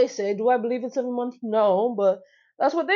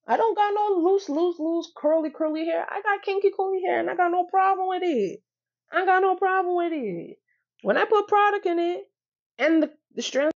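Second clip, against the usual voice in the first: higher, excited and proud; proud declaration; 8 semitones higher than usual. Real, not acted. Vlogger proudly describing her own kinky curly hair, excited. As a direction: beam about your own kinky hair.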